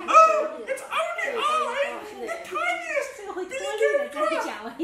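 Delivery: tiny high squeaky goat voice; very small voice. A narrator's reading. Youngest goat answers the troll, in a very small voice. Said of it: "in his very small voice".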